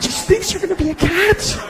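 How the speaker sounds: high, sing-song imitation of the wife; hammed-up high wife voice; mock wife voice. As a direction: pitch it way up like the wife. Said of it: "She's like".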